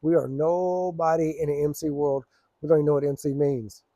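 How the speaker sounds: ordinary talk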